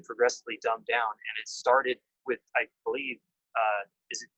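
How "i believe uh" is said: normal talking voice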